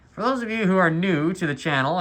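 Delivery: talking through a face mask; muffled by a mask. Real, not acted. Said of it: "we'll say this for the second time".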